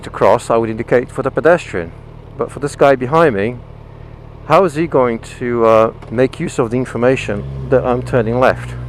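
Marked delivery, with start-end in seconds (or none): none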